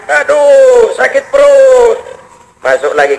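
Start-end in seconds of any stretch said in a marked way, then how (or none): 0.1-2.0 slow, pained whimper over a stomach ache; a mild mock moan of pain. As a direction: groan it out like a man doubled over. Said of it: "orang ngerintih kayak tadi".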